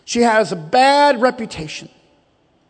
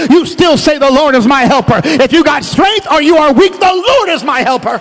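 high, louder preacher's refrain; preaching; 4 semitones higher, 5 dB louder than usual. Acted. Pastor urging the church to stand on the verse, fervent preaching. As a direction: rouse the room with each repetition. Rousing repeated declaration.

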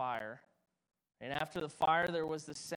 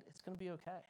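calm reassuring God; 5 dB quieter, faster than usual. Pretend. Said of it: "God calmed our hearts and said".

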